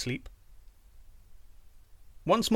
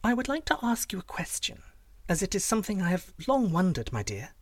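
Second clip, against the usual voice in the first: coaxing princess; 7 semitones higher than usual. Acted. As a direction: ask sweetly, high and wheedling.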